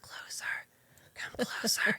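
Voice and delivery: barely audible breathy whisper; mock dying mother whispering to daughter; faint dying whisper. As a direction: beckon weakly from a deathbed.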